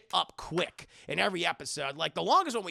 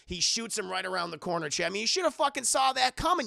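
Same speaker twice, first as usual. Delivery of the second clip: ordinary delivery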